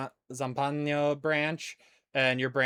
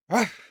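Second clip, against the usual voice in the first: dog bark; 6 semitones higher than usual. Pretend. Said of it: "Pronounce the".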